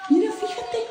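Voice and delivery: high, fast, alarmed pointing out; alarmed excitement. Real, not acted. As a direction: urgently show them what the doll is doing. Doll moves toward daughter's room.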